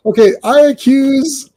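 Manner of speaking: high, loud mock accusation; dramatic accusation. Blue Eagle accusing a squadron member, hammed-up and dramatic. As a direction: declare it like a grand reveal.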